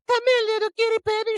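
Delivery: high, fast cooing granny; doting grandma voice. Retold or imitated. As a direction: coo at her like a doting grandma. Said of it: "the Nana is just all like".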